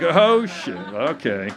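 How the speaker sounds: high, loud sudden "oh shit" then a flat okay; jokingly startled exclamation; mock dismay. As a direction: act caught off guard by the discovery. Dismayed reaction to getting shirts back.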